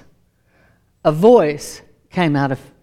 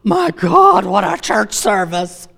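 amazed; slower than usual. Acted. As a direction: play an overawed disciple, hammed-up. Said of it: "like, amazed".

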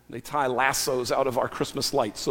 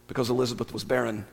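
usual speaking voice

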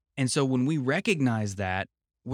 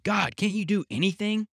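exasperated, pitched up high; a fed-up father snapping at his struggling child; impatient; 8 semitones higher than usual. Retold or imitated. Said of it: "father says impatiently".

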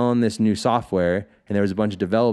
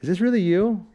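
doubtful bouncer; 10 semitones higher than usual. Retold or imitated. Bouncer checks fake ID.

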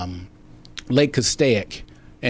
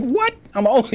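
shocked disbelief; 13 semitones higher than usual. Retold or imitated. Told he looks too young, answers in shocked disbelief. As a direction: pitch leaps way up in mock outrage. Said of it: "I'm like".

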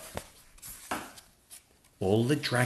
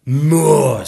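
loud, higher drawn-out demand; demanding dragon teacher; 6 semitones higher, 13 dB louder than usual. Acted. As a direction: demand it from your dragon pupils. Dragon teacher demands louder roar.

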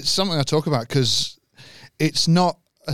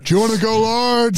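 raised wrestler promo voice; The Rock; 5 semitones higher than usual. Acted. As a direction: take a burger order like a promo. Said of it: "Imagine Rock working at McDonald's".

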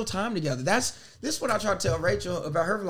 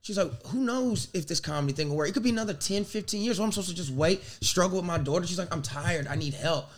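softer, lower, worn-out pleading; tired, defeated asking for help; exhausted girlfriend begging quietly; weary plea; 3 semitones lower, 6 dB quieter than usual. Retold or imitated.

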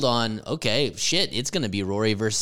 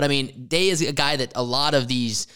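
ordinary talk, nothing marked

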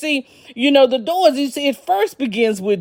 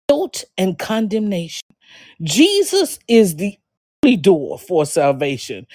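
ordinary talk

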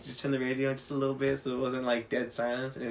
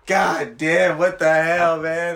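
exasperated groan; 4 semitones higher, 8 dB louder, much slower than usual. Retold or imitated. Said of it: "I'm like".

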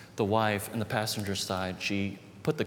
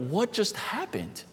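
higher, slowed, baffled question to himself; stunned wonder; 9 semitones higher than usual. Retold or imitated. Child in shock over the empty bag, baffled and high. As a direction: let the surprise lift it high and slow. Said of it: "I wondered".

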